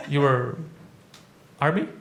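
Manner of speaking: usual speaking voice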